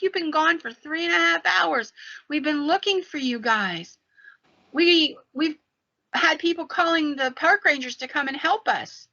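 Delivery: high-pitched, worked-up reproach; exasperated scolding. Retold or imitated. Husband to wife after searching for hours, exasperated scolding. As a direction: retell a frantic husband's telling-off.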